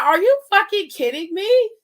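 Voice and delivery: high, loud outraged disbelief. Retold or imitated. Outraged reply in retold chat.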